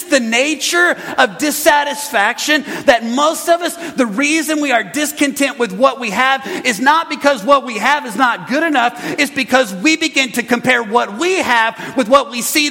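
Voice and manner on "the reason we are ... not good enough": ordinary talk, nothing marked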